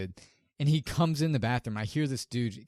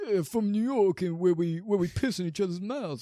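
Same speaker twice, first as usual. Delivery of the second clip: higher, louder hammed-up New York voice; mock New York accent; 9 semitones higher, 3 dB louder than usual. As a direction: play the Giants fan, New York style. Mocking a New York guy.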